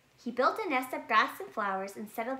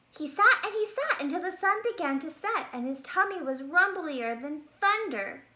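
higher, faster and louder; building narration; 7 semitones higher, 5 dB louder than usual. A narrator's reading. Narrator builds up the long hungry wait. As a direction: build it up to the rumbly thunder.

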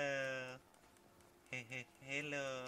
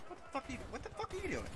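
incredulous yell; 13 semitones higher, much faster than usual. Real, not acted. Gamer yelling at his reckless teammate, incredulous.

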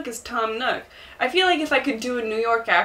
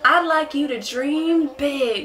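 slow, higher Southern salesman pitch; Tom Nook; 4 semitones higher, slower than usual. Acted. Pitching islanders like a multi-level marketer, Southern drawl. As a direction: sell the dream with a stretched Southern drawl. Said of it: "like a pyramid scheme multi-level marketer".

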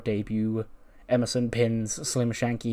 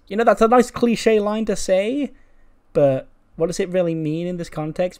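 higher, louder acted objection; doubtful reply; 8 semitones higher, 6 dB louder than usual. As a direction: raise the pitch to question a friend's line.

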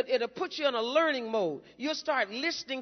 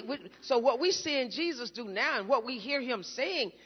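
ordinary delivery

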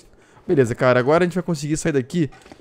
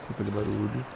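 whispering; 5 semitones lower, 11 dB quieter than usual. Real, not acted. Hiding, repeats phrase whispered.

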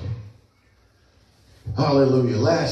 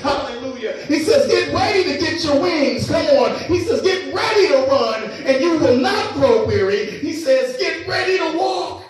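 rising, louder fiery preaching; 8 semitones higher, 5 dB louder than usual. Acted. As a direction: build to a full-force climax. Preaching climax building up.

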